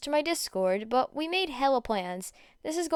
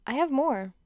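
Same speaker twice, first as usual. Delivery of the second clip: ordinary delivery, nothing marked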